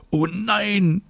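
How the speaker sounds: very high drawn-out groan; high whiny groan of dismay. A narrator's reading. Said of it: "stöhnt David".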